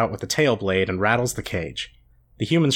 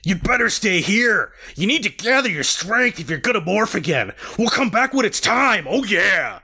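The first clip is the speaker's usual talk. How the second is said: Rachel voice; 10 semitones higher than usual. Acted. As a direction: play Rachel bossing him. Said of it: "we were gonna do".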